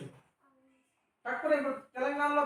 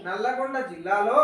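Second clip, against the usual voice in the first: slow and louder, each syllable clear; slow model reading; 5 dB louder than usual. Shown as a demonstration. Teacher to pupils, reading slowly for them to repeat. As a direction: read out for the class to echo. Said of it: "sir ఎలా పలుకుతున్నాడు".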